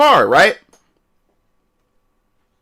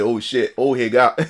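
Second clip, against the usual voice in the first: much lower, softer mock mumble; impersonating the corner guys backing off; scared guys; 12 semitones lower, 4 dB quieter than usual. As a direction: back down mumbling to each other.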